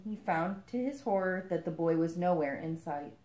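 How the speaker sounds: plain reading voice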